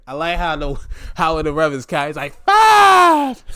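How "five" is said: high, loud shout; loud ref count; mock shouted count. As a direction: bark the number like a ref.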